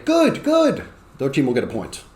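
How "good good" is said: very high, quick student shout-out; eager student. Acted. Student races to answer for a team point, high and eager. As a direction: blurt it out in a squeaky high pupil's voice. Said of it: "The first student to say".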